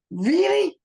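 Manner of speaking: incredulous. Acted. AJ disbelieving fire system.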